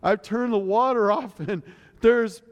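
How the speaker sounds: a high, panicky rush, toned down; imitated panic. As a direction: mimic the daughter's flustered alarm. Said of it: "I'm putting it mildly compared to the way she said it".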